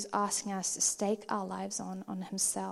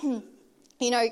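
ordinary delivery

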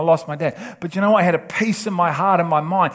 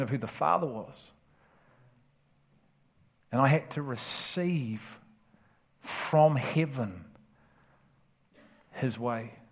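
much softer, hushed and intimate sermon close; soft prayerful preaching; 5 semitones lower, 9 dB quieter than usual. Acted. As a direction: draw them in with a hushed, prayerful voice.

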